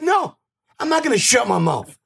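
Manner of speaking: high-pitched mock defiance; retold defiant comeback. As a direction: refuse hotly, pitch leaping up.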